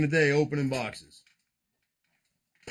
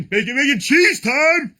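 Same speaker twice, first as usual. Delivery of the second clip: higher, louder, slow drawn-out announcement; hype call; 7 semitones higher, 7 dB louder, slower than usual. Real, not acted. Host hyping the sandwich for the viewers, slow loud call. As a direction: call it out like a game-show host.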